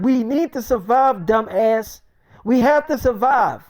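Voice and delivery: high, loud snapping back; defiant retort. Retold or imitated. Wife shouting back at the friend, defiant. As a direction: fire it right back at him.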